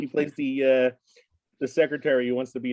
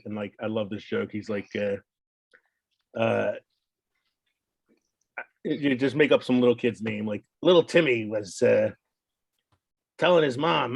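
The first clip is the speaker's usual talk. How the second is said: ordinary delivery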